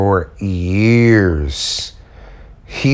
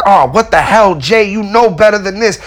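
exasperated self-scolding, raised; scolding himself; 8 semitones higher than usual. Retold or imitated. Woodworker scolding himself after a near accident, exasperated. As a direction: snap at yourself for a careless slip. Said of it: "I was like".

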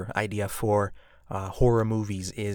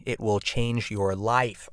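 emphatic slow; much slower than usual. Real, not acted. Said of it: "emphatic slow pitch".

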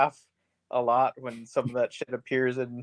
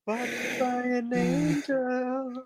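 slow, higher, hammed-up lament; mock drawn-out wail; 8 semitones higher, much slower than usual. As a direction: stretch it out in mock revelation. Mock quote of the film's angel twist.

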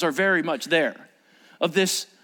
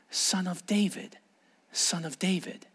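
quiet reflection; 7 dB quieter than usual. Shown as a demonstration. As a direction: say it softly, letting it sink in.

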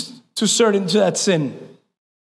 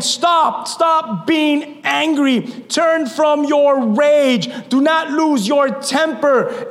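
slow preaching at full force; 9 semitones higher, much slower than usual. Acted. Pastor commanding the church to stop, slow forceful preaching. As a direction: drag out each command.

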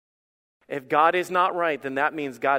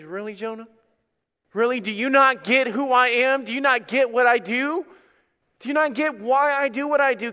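exasperated God; 6 semitones higher, 4 dB louder than usual. Acted. Preacher voicing God's exasperated reply.

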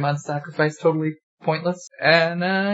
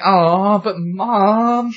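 put-on whiny kid; 4 semitones higher, 4 dB louder, much slower than usual. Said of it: "And they're like".

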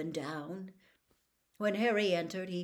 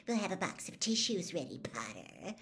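hissed jeer. A narrator's reading. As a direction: jeer under the breath, sinking on the name. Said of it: "or hissing".

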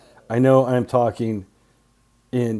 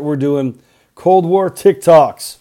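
ordinary talk, nothing marked

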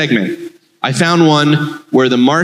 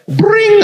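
high ringing bring; phone ring again. Shown as a demonstration. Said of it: "Can you do that?".

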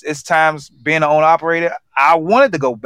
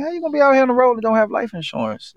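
incredulous; 9 semitones higher, faster than usual. Retold or imitated. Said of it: "I'm like".

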